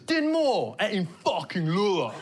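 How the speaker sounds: mock shout, drawing out the last words; imitated shout. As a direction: yell like an outraged football fan, imitated. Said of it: "somebody shouted".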